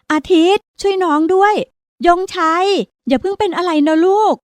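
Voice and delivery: high, panicked cry to save her son; a terrified mother calling across the water; frantic shouting. Acted. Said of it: "ตะโกนฟังแทบไม่ได้สับ". (แม่ตะโกนเรียกลูกอย่างตื่นตระหนก เสียงสูง)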